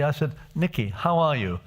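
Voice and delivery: ordinary delivery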